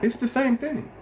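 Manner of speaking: same thing thrown way up high; emphatic high repeat. Shown as a demonstration. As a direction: hammer the point with a high exasperated repeat. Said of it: "repeated line".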